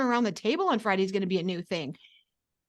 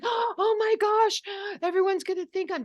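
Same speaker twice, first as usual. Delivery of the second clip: high, slow, hammed-up worried gasp of a voice; mock panic; 10 semitones higher than usual. Advising listeners, she mocks their panicked worry with high drawn-out alarm. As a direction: play the anxious believer, much higher and drawn out. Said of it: "So don't think".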